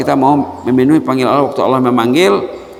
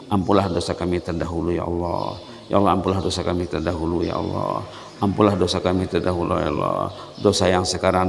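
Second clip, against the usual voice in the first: closing prayer; 3 semitones lower than usual. Acted. Preacher leading the closing doa. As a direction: lead the congregation in earnest prayer.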